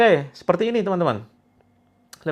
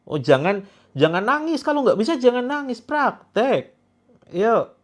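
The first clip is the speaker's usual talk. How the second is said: mock teacher to kids; 8 semitones higher, 3 dB louder than usual. Teasing viewers as crying children.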